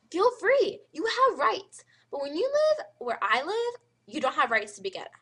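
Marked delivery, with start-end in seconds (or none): none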